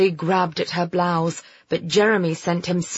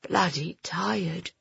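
soft, slow sleepy mumble; worn-out boy, barely audible; drowsy mutter; 7 dB quieter, slower than usual. Acted. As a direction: mutter it slowly, half asleep. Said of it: "he muttered".

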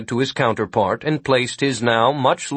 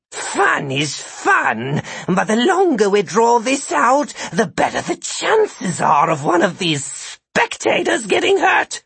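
high monster voice; 11 semitones higher than usual. A narrator's reading. Sid speaks in dialogue.

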